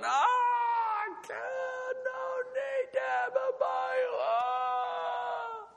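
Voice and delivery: high, far-carrying hammed-up wail, half sung; mock wailing. Preacher hamming up a father's grief over lost sons, mock wail. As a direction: wail like a grieving mourner, overplayed for laughs. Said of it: "I'd be weeping and, and wailing".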